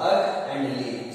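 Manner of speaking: ordinary talk, nothing marked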